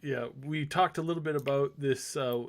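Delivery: ordinary delivery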